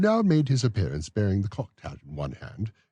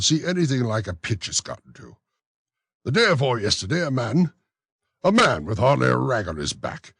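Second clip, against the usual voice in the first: raised, fussy official's voice; pompous beadle; 7 semitones higher, 7 dB louder than usual. Acted. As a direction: play the self-important beadle. Bumble continues.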